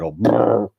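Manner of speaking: mock dazed "oh" into a brr; imitated brr noise. Streamer mimicking his spaced-out eyes, imitated brr. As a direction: act it out with a buzzing brr.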